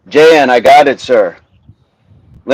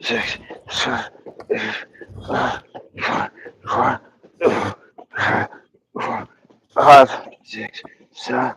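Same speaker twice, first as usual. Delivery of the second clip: breathless, mostly voiceless counting through the reps; winded counting; 7 dB quieter than usual. Real, not acted. Coach doing reps, counting out of breath. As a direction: count while gasping for air. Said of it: "I'm already winded".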